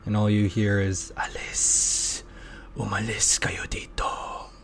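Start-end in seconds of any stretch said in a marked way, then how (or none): 1.2-4.5 hissing creature whisper in Tagalog; whispered foreign tongue. Acted. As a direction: breathe the words like an unseen spirit. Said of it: "It speaks in a foreign tongue".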